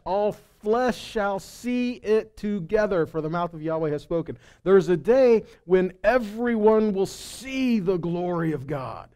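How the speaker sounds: usual speaking voice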